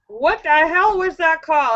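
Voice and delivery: ordinary talk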